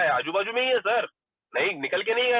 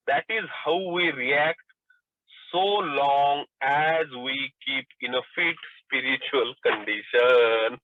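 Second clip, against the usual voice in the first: reads the quoted text very slowly, word by word; slow reading aloud; 4 semitones lower, much slower than usual. Acted. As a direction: savour each word of the book for the group. Reading the Big Book aloud.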